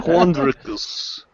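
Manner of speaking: put-on slow, laughing Russian accent. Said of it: "say this dude's name in the Russian accent".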